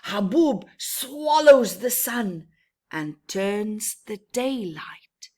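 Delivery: dramatic storm telling, softening to darkness at the end; ominous storytelling. A narrator's reading. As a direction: make the sandstorm menacing. Ominous sandstorm passage.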